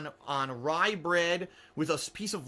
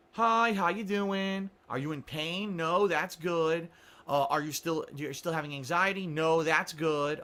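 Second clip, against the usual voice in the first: slow counselor; slower than usual. Retold or imitated.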